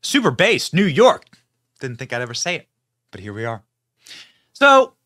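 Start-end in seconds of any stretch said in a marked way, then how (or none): 0.0-1.2 hype shout. Real, not acted. Host greeting crowd loudly. 4.5-4.9 loud, much higher opener. Real, not acted. Host grabbing the crowd's attention, loud.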